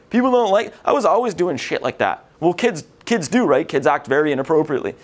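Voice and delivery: ordinary delivery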